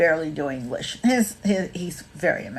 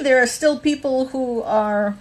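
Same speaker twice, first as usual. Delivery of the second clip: usual speaking voice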